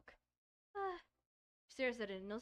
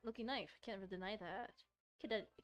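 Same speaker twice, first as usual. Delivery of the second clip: usual speaking voice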